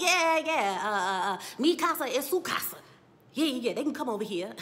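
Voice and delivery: loud, fast, flustered imitation; mock hasty agreement. Acting out Joseph hastily agreeing.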